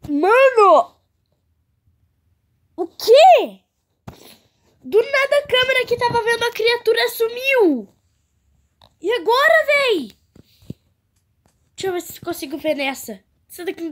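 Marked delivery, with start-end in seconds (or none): 0.1-10.2 loud, high shocked outburst. Real, not acted. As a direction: blurt it out in alarm. Camera with creature disappears.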